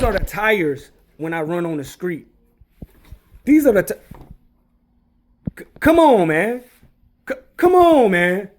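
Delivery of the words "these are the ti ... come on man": loud, high, fed-up cry of disbelief; exasperated outburst. Real, not acted. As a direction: throw up your hands in disbelief, loud. Ranting about fake scale tires.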